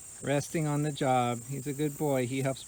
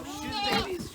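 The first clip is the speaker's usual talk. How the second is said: much higher herding call; calling out to the animals. Real, not acted. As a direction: sing out to move the flock. Calling animals across the yard.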